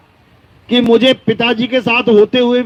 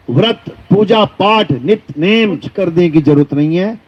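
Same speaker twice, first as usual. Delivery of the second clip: normal talking voice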